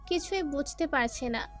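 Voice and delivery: ordinary delivery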